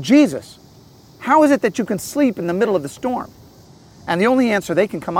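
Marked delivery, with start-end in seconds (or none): 0.0-3.3 higher-pitched, confused complaint; a mild acted accusation, raised in pitch; anxious, angry disciples. Disciples waking Jesus in the storm, anxious and upset. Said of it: "They're angry. They're confused. They're saying".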